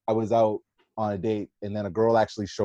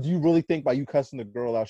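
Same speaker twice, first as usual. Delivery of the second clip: ordinary talk, nothing marked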